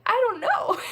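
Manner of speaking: high, loud, laughing helplessness; giggly I don't know. Retold or imitated. Asked by the kids.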